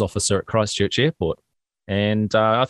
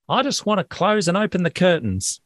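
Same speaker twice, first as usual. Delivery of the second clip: put-on higher little-kid voice; lightly mimicked child, pitched up; imitated young daughter; 7 semitones higher than usual. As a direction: play a kid making a hopeful offer. Voicing young daughter's chore request.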